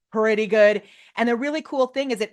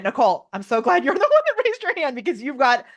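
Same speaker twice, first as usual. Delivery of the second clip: gushing higher, with a laugh breaking in; so thrilled you get carried away; excited, laughing praise rising in pitch; real excitement; 4 semitones higher than usual. Real, not acted. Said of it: "I'm so excited. Sorry, I'm talking so loud".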